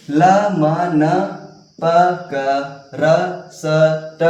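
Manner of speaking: "ल म न प क र स ट" as isolated sounds with pauses; letter-sound drill. Shown as a demonstration. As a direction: chant each of "ल म न प क र स ट" as consonant plus a. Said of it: "इसको क्या पढ़ेंगे".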